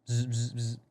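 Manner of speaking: three short buzzing zzz sounds; imitated phone buzz. Imitates his phone vibrating.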